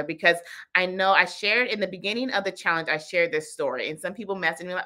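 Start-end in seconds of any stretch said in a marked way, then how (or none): none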